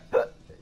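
sudden sharp hiccup; involuntary catch of breath in a hiccup. Real, not acted. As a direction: let a hiccup jump out. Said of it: "Yep, there's the hiccup".

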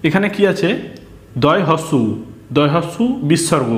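ordinary talk

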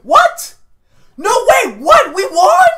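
loud, high disbelieving yell; shocked shout. Real, not acted. Shocked reaction to the win.

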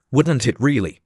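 ordinary delivery

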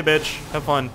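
ordinary talk, nothing marked

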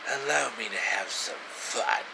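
low, soft menacing villain voice; lightly voiced creepy villain, low and hushed; sinister villain. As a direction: purr it like a scheming snake. Villain Orochimaru speaking to Sasuke.

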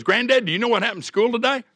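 light imitation of a seven-year-old bursting with news; excited little granddaughter. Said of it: "granddaughter comes to me and goes".

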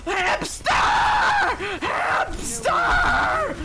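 loud, high drawn-out shout; shouted callout. Real, not acted. Player yelling for Hamster mid-match, shouted callout. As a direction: yell it out, stretched long.

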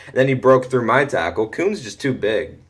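ordinary talk

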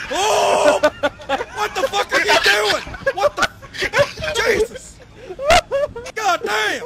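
panicked shouting. Real, not acted. Player ruins the plan.

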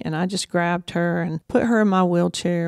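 normal talking voice

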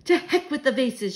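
exuberant shout. A narrator's reading. Grandmother ignores the warning, shouting. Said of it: "she shouted".

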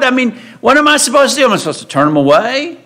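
acted exasperated defence; defensive protest. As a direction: protest rapidly as if wrongly blamed. Preacher voices Moses defending himself.

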